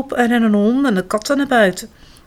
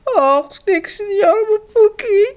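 high, weeping slow lament; crying; 12 semitones higher, 4 dB louder, slower than usual. A narrator's reading. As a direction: wail through tears. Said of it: "begint ze vreselijk te huilen". (huilend klagen)

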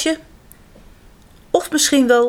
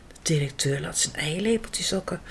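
whispered narration; 4 semitones lower, 9 dB quieter than usual. A narrator's reading.

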